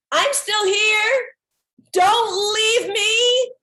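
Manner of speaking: high, loud and slow, stretched calling. Retold or imitated. Calling out to the Swami, begging not to be left, loud. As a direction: call across the bus so he won't leave. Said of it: "calling out to this wonderful, friendly Swami".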